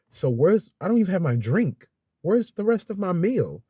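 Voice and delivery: retold rising frustration; frustrated demand. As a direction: demand the missing meal, getting heated. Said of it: "I was like".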